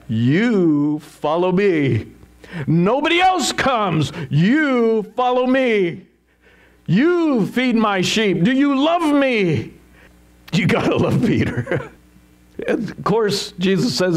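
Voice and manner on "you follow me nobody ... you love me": slow, insistent commands climbing higher; emphatic. Acted. Jesus pressing Peter to follow and feed sheep, emphatic. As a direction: drive each phrase home, stretching the key words. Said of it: "This is so emphatic".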